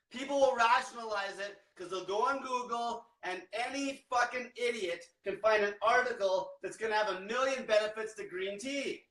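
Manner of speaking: ordinary talk, nothing marked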